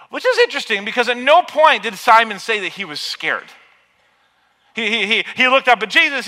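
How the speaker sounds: loud, rising, impassioned preaching; full-force preaching. Acted. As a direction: drive it home to the congregation. Preaching loudly.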